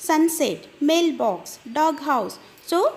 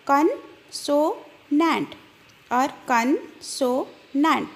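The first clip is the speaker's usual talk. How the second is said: slow syllable split; much slower than usual. Shown as a demonstration. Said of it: "you can split it into".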